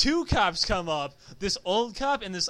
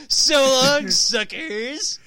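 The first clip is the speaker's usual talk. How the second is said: loud, raised-pitch comic farewell; gleeful pretend taunt, shouted; mock getaway shout; 5 semitones higher, 9 dB louder, much slower than usual. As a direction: holler it like a cartoon robber escaping. Imagined bank robber shouts goodbye.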